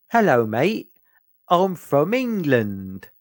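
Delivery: mocking a cheesy Cockney English accent; generic mock English accent.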